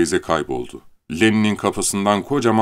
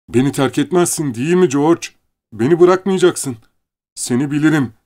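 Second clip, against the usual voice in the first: pleading; 8 semitones higher, 5 dB louder than usual. Acted. Leni pleading George.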